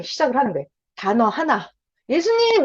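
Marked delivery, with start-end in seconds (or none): 2.1-2.6 high, eager call of "예수님"; calling out to Jesus. Shown as a demonstration. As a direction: call him like a child calling a parent. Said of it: "이렇게". (높고 간절하게 부르는 "예수님!")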